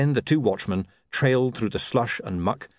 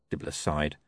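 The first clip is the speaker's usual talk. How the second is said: ordinary delivery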